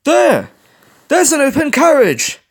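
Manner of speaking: high, loud call from above; urgent lookout's call. A narrator's reading. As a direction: shout it down to the others. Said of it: "Perry called down".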